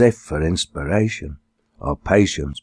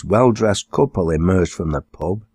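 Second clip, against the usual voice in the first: ordinary delivery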